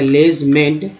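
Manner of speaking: लेजमेंट sounded out, ending मेंट; slow syllable reading. Shown as a demonstration. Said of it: "E N T को एंट बोलते हैं".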